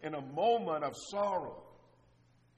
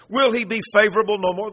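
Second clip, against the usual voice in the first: loud pleading cry; 4 semitones higher, 15 dB louder than usual. Acted. Preacher voicing the psalmist's plea.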